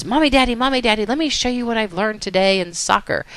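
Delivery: acted eager daughter voice; eager little girl. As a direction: play a kid bursting to show her parents. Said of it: "and she says".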